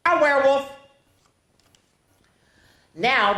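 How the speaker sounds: plain reading voice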